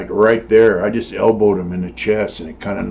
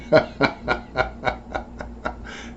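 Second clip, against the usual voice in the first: long, breathy chuckle; amused laugh; 5 semitones higher, 5 dB quieter than usual. Real, not acted. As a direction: chuckle helplessly at your own prank. Laughs at his own prank story.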